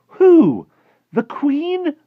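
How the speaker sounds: very high, very loud disbelief; aghast cry. A narrator's reading. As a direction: cry out appalled. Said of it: "Sophie cried aghast".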